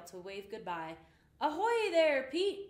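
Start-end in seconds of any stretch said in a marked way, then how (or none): 1.4-2.7 high, loud farewell call. A narrator's reading. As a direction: call across the water to a friend. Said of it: "he called".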